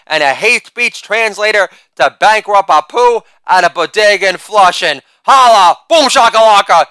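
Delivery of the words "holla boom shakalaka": loud, high shouted hype word; catchphrase shout. Acted. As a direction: bark it out to the crowd. Catchphrase shouts after joke.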